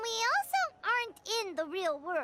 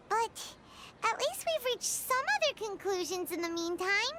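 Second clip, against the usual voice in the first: ordinary delivery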